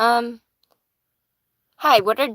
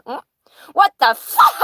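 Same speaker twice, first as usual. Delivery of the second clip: high, loud parrot scream; shrieked bird impression, far above normal pitch; screaming parrot imitation; 7 semitones higher, 8 dB louder than usual. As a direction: mimic a parrot suddenly screaming the phrase. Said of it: "And he just screams it".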